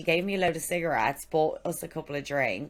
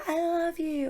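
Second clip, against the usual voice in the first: high-pitched imitation of a screaming fan; retold street scream, held back; mock scream. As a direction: holler it high without full volume. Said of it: "a woman screaming down the street".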